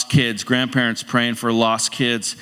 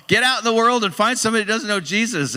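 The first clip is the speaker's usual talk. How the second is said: urging; 9 semitones higher than usual. Retold or imitated. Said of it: "And I'm like".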